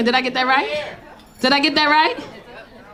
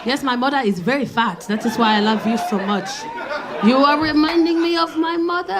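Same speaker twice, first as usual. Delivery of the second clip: put-on African accent. Date answers in his voice.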